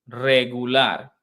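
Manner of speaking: "Regular" said slowly, Spanish r rolled; correct spelling. Shown as a demonstration. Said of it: "the word is regular".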